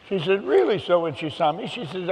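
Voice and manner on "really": high-pitched, retold surprise; a high, disbelieving "really?"; surprised sister. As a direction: echo her surprise, pitched right up. Sister reacts to surprising news.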